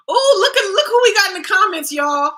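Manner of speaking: high, loud, fast gleeful call; excited callout. Real, not acted. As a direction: crow over a catch in the comments. Calling out a commenter.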